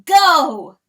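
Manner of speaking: a loud, high, ringing shout; crowed command. Acted. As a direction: bark the word like a rooster crowing it out. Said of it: "he crowed".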